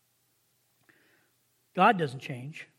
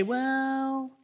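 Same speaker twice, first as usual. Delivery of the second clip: long drawn-out, higher 'well'; wavering parent; 4 semitones higher than usual. Acted. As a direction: stretch it out like a parent backing down. Voices parent wavering on threat.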